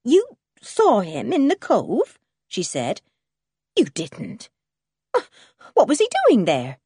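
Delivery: taken aback, voice lifting; disbelieving. A narrator's reading. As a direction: question it in shocked disbelief. Farmer's wife hears shocking news.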